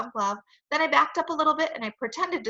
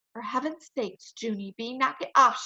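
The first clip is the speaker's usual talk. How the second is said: ordinary delivery, nothing marked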